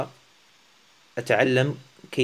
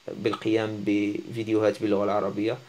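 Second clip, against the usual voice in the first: usual speaking voice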